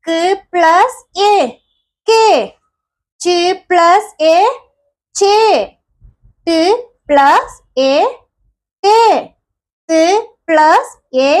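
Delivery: slow, clear sounding out of each part; slow phonics drill. Shown as a demonstration. Teacher slowly sounding out letters for young pupils. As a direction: spell it out piece by piece for the children. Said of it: "okay, എന്താ ഇന്ന് പഠിക്കുന്നത് നമ്മള്?".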